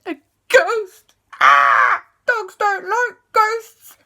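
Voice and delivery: high loud yelp of alarm; alarmed puppy. Acted. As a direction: squeal in fright like a pup. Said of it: "Sky went".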